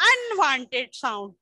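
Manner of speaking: each word drawn out slowly for the class; slow dictation pace. Shown as a demonstration. Teacher to class, dictating slowly. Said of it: "Loud and".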